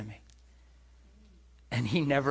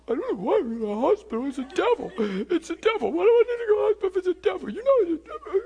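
high-pitched, hammed-up whimpering mumble; tearful mumbling; 5 dB louder, faster than usual. Man protesting the hospital to himself, pitiful high mumbling. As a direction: babble in a rising, pitiful mock cry. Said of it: "I'm mumbling".

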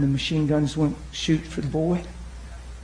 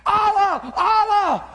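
high-pitched mock cries of panic; acted frantic shouting; imagined screaming; much slower than usual. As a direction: cry it out like terrified kids, acted. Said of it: "He should be screaming".

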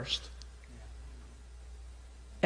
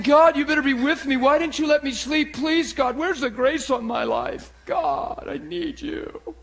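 hammed-up aggrieved prayer, dwindling into neediness; grumpy complaint; 7 semitones higher than usual. Preacher acting a sleepless believer blaming God, grumpy pleading. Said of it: "waking up at six grumpy".

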